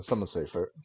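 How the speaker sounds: ordinary delivery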